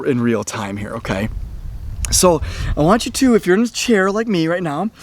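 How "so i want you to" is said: ordinary talk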